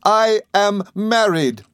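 high, slow, ringing proclamation; thrilled announcement. A narrator's reading. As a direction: announce big happy news, stretching each word. Thrilled announcement of his marriage.

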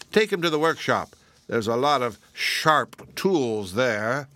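slow, menacing order; cruel pumpkin. Acted. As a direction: relish the threat of tools.

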